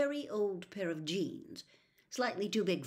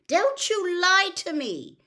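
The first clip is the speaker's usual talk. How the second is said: furious yell; 13 semitones higher, 12 dB louder, slower than usual. Acted. Mother scolds caught son.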